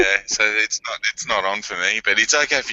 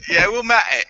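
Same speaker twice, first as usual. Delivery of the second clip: higher, put-on English accent; 9 semitones higher than usual. Shown as a demonstration. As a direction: repeat it as a hammed-up English catchphrase. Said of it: "do it in an English accent".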